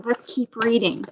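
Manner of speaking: usual speaking voice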